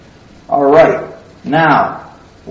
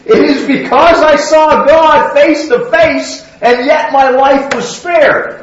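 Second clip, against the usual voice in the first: excited; 8 semitones higher, 6 dB louder than usual. Acted. Preacher exclaims Jacob's words.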